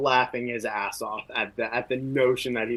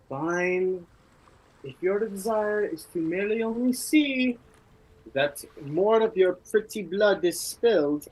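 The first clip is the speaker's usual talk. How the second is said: higher, weary grudging reply; grudging warrior; 8 semitones higher than usual. Acted. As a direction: give in with a sigh. Brynjar reluctantly accepts.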